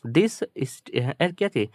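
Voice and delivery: ordinary talk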